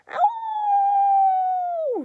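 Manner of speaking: shrill witchy screech, loud; old grandma's piercing screech; high crone scream. A narrator's reading. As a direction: yelp in shock. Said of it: "she screamed".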